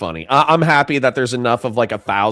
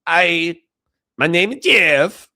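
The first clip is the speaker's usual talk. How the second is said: higher, slow wooden bad Mexican accent; a cop who clearly can't do accents; 5 semitones higher, 4 dB louder, slower than usual. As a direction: stiff failed accent with a laugh. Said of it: "'Cause he can't do accents".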